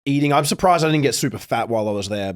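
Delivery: ordinary talk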